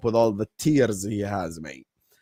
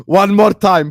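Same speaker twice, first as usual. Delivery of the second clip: hype shout; 7 semitones higher, 10 dB louder than usual. Real, not acted. Hyping chat for replay.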